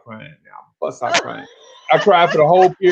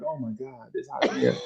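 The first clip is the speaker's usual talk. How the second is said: overheated muttering to self; 4 semitones lower, 10 dB quieter than usual. Retold or imitated. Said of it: "I'm like".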